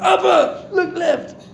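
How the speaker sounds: put-on high, excited little boy's voice; imitating his young son. Father retells his son calling out in the headset, high and excited. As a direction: squeak it out like an eight-year-old boy. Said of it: "he was like".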